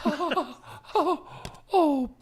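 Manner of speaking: very high, soft cartoon bear voice; Winnie the Pooh. Host clowning as a honey-loving bear, in a high put-on voice. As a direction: attempt a slow, high-pitched storybook bear. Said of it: "I'm Winnie the Pooh".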